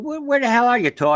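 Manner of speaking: hollering to find him; mock calling out. Outfitters phoning the lost paddler, high mock shout. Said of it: "they said".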